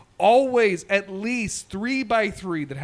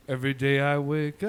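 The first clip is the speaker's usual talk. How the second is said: coffee ad voice; 4 semitones lower than usual. Retold or imitated. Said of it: "That's the Folgers coffee ad".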